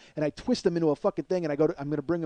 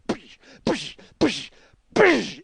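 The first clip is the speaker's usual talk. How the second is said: percussive "psh" hits, last one biggest; mouth-made pounding sound effects; imitated smashing noises; 5 semitones higher, 5 dB louder, much slower than usual. Storyteller pounding it with the receiver, explosive impact noises.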